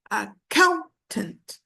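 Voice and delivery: "accountant" slowly, a-COUN-tant, with COUN stretched; stress on second syllable. Shown as a demonstration. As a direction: lean hard on COUN in "accountant". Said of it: "The stress is on the second syllable".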